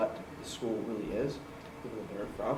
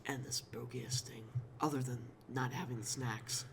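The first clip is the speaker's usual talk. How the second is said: spooky story narration; 9 dB louder than usual. Acted.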